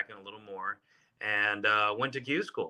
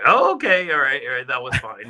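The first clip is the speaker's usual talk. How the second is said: mock surprised wife; 4 semitones higher, 6 dB louder than usual. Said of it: "and she was like".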